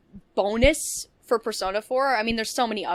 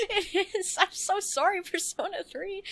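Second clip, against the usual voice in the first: laughing apology; 11 semitones higher than usual. Real, not acted. Laughing apology to the old game.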